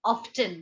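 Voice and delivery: often with the t sounded, OFF-tun, falling; spoken t. Shown as a demonstration. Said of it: "Britishers होते हैं वो often बोलते हैं".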